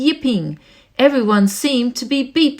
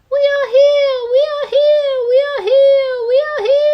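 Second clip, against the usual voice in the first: much higher, louder squeaky crowd chant; tiny crowd crying out; 13 semitones higher, 4 dB louder, faster than usual. Acted. The Whos shouting to be heard, high and loud. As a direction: cry out like tiny frightened people. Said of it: "They cried out in fear".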